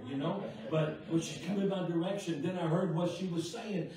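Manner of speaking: ordinary talk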